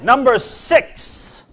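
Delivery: big comic top-ten reveal; countdown announcer. Acted. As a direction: announce each entry with mock grandeur.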